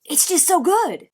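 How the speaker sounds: high, slowed, emphatic praise; gushing enthusiasm. Real, not acted. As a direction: gush as if amazed by the talent.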